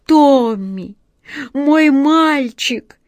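high, loud emotional exclaim; excited cry. Acted. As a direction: call out to the boy, overjoyed. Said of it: "с волнением воскликнул".

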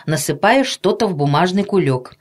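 usual speaking voice

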